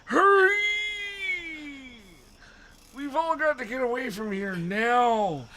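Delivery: high, urgent character voice; high, hammed-up panicked old man; Mister Dawes. A narrator's reading. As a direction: put on a high voice, shriek the hurry. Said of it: "Mister Dawes said".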